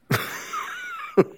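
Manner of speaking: a short, bitter laugh at his own joke; one short, disbelieving laugh. Real, not acted.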